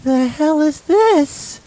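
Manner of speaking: high, slow horrified question. Acted. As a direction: stare at the blood, aghast. Finding blood.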